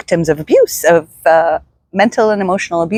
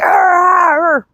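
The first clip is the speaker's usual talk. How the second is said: primal yell; 13 semitones higher than usual. Retold or imitated. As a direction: let out a raw cathartic yell. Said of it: "I started to just scream and yell".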